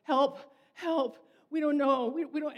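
the stray dog begging for help, pleading; voicing a panicked dog. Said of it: "they're like".

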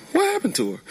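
sudden high alarmed question; startled. Retold or imitated. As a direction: jump way up in alarm. Dog vanishes at the dock.

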